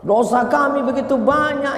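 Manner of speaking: high-pitched retold prayer of repentance; mock-earnest, high penitent lament; muhasabah plea. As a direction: imitate leading a congregation in tearful confession. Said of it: "muhasabah lima menit".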